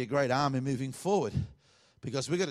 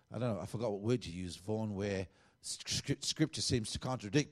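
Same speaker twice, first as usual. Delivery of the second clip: ordinary talk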